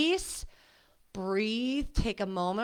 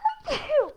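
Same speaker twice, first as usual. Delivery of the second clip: sneezing; 4 dB louder than usual. Real, not acted. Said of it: "I'm gonna sneeze again".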